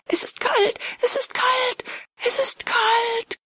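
very high, terrified repeated cry; thin, panicked high wail; high-pitched calling out. A narrator's reading. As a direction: cry it out in falsetto, stretching the last one. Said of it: "Er rief".

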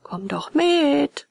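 high, drawn-out call; long, high-pitched pleading shout; crowd's shout. A narrator's reading. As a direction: call out slowly to coax someone along. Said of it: "schrien alle aus dem Wagen".

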